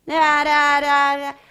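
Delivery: loud, high droning read-aloud. Shown as a demonstration. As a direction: blare it out like mechanical reading. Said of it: "this is her reading voice".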